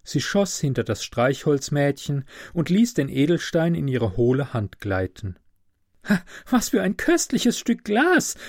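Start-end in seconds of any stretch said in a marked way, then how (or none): 6.1-8.3 put-on high-pitched little girl exclaiming; delighted cry. A narrator's reading. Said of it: "rief das kleine Mädchen". (jubelnder, hoher Ausruf eines Mädchens)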